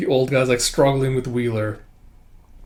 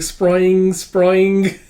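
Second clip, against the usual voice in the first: high, loud cartoon boing; spring out each word like a toy blade; imitated spring noise; 6 semitones higher, 6 dB louder than usual. Manga fan mimics a toy knife's blade, cartoon spring noise.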